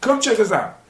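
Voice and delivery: ordinary delivery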